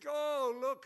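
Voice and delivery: high, loud exasperated cry; exasperated Moses. Pretend. Preacher imagining Moses crying out to the Lord, high and loud. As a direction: throw up your hands at the crowd.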